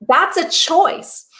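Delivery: usual speaking voice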